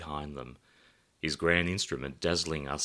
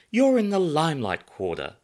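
hostess reply; 11 semitones higher, 9 dB louder than usual. Acted. Samantha replies same words.

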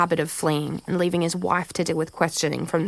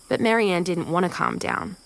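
ordinary delivery, nothing marked

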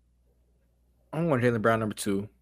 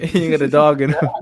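loud laughing echo of the line; laughing repeat; 5 semitones higher, 10 dB louder than usual. Real, not acted. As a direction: laugh it out, louder. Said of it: "what's that name you said?".

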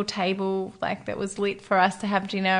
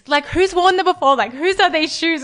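higher, louder baffled questions; confused questioning; 7 semitones higher, 7 dB louder, slower than usual. Retold or imitated. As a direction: pepper her with puzzled questions. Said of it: "And I'm so confused".

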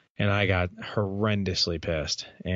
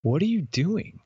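startled wife; 8 semitones higher than usual. Retold or imitated. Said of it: "my wife was like".

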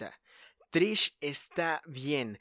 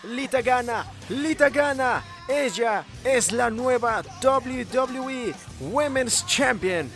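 loud, high victory call; shouting the win; 9 semitones higher, 7 dB louder, slower than usual. Acted. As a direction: announce the champion at full voice. Announcing the new champion.

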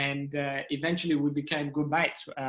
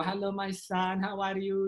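higher-pitched imitation of a polite mother; mom impression; 5 semitones higher than usual. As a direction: greet your son as a loving mom. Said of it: "when I pretend to be my mom".

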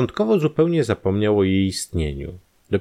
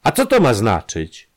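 louder, higher indignant cry; mild shout of alarm; shouted question; 5 semitones higher, 6 dB louder than usual. Acted. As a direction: demand an explanation, voice raised. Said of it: "krzyknął".

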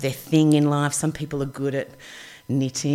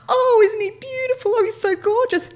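high, cooing gush over a dog; gushing women; 5 dB louder than usual. Retold or imitated. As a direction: coo like someone smitten with a puppy.

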